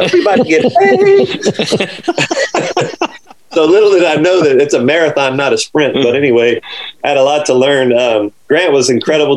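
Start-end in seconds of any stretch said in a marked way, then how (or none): 0.1-3.2 high, laughing gloat. Real, not acted. Caddy telling his girlfriend about the money, laughing. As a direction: crow about the payday.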